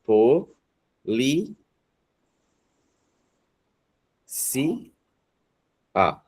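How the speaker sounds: Po-, li-, si-, a with long pauses between; Po-, li-, si-, a, each one apart; syllable by syllable. Shown as a demonstration. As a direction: split polícia into four slow beats. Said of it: "polícia separa assim, ó?".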